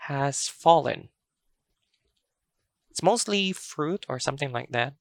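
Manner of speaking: normal talking voice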